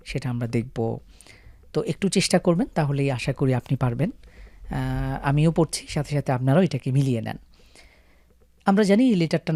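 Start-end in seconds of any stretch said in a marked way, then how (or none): none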